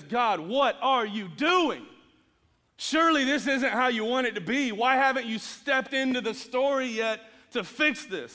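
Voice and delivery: mild mock outcry, asking God why; pleading prophet. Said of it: "and he says".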